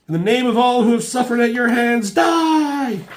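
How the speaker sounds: high, loud screaming war cry; battle cry. Acted. Deathbird shooting the fake X-Men, high screaming battle cry.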